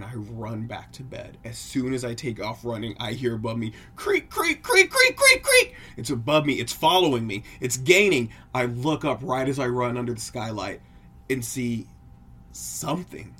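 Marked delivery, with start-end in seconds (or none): 4.0-5.7 very high, loud squeaked creaks; rapid creaking noises. Acted. Imitates pursuing creaks overhead. 6.0-10.1 loud, mounting urgency; urgent alarm. Acted. Chase climax of scary story.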